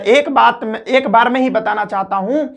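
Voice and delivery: normal talking voice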